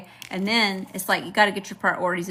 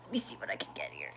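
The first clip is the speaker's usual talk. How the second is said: whispered, barely audible aside; whispering to oneself; 13 dB quieter, faster than usual. Real, not acted. Reader checks the cards to herself, whispered. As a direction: drop to a private whisper. Muttering to self while reading cards.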